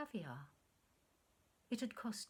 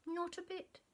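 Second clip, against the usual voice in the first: forced lightness; 10 semitones higher than usual. Acted. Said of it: "she lied".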